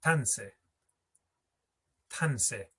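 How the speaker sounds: Tanse in two syllables, TAN then se, no short i; dropped middle syllable. Shown as a demonstration. Teacher showing the unheard syllable, Tanse with i dropped. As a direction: let a stressed TAN swallow the i in Tanse. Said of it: "don't hear this syllable".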